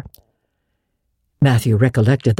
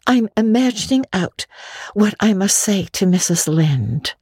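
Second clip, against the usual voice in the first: dreamy Anne; 6 semitones higher than usual. A narrator's reading. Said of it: "answered Anne dreamily".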